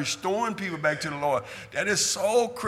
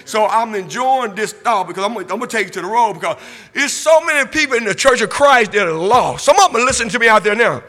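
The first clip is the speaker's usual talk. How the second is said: louder, higher preaching that swells into shouts; full-force preaching; 5 semitones higher, 9 dB louder than usual. Acted. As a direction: preach it out to the congregation, building hard. Preaching resumes after glasses remark.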